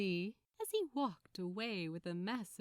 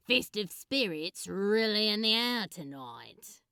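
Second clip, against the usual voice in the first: louder, dry sarcastic rant; sarcastic drawl; 8 dB louder than usual. Acted. Crowley grumbling sarcastically at Aziraphale about the crowds. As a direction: roll your eyes through it, then slow. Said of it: "Crowley drawled sarcastically".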